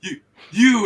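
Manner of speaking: loud panicked shriek; horrified. Acted. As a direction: scream in horror at your own body. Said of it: "he goes".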